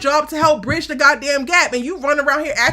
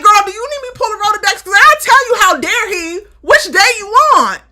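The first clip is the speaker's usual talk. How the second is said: loud, higher and fast; heated rant; 7 semitones higher, 9 dB louder, faster than usual. Retold or imitated. Co-host loudly rattling off his record to Simone. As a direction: fire back at her, rapid and outraged. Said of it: "I'm like".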